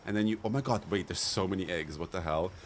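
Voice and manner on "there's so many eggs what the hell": ordinary talk, nothing marked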